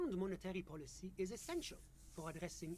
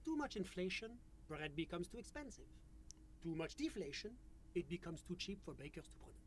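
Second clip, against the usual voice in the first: put-on French-accented official; measured, polite French accent; 4 dB louder than usual. GM voices official NPC.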